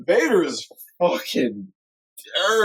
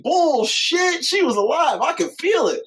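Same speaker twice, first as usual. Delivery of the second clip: much higher, protesting Anakin imitation; Anakin's outburst; 12 semitones higher than usual. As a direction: squeak out a disbelieving denial. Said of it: "And he's like".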